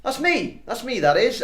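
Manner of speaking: high, eager shout; excited. Acted. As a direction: jump in with eager delight. GM voices excited NPC Thorsk.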